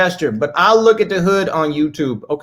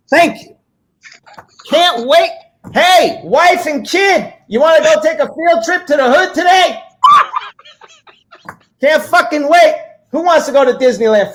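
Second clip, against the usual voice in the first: loud, high sarcastic enthusiasm; mock excitement; 7 semitones higher, 4 dB louder than usual. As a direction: hype up the family trip with fake glee.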